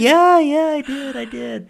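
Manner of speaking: high, giddy-sounding yes, easing off; excited reply. Retold or imitated. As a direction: answer a squeal in a high voice. Acted reply to squealing coworker.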